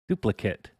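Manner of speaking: DUPLICATE ending in a clipped "kit"; noun pronunciation. Shown as a demonstration. Streamer testing the word aloud, Duplicate ending in "kit". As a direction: say Duplicate as a noun, last vowel reduced. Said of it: "the noun".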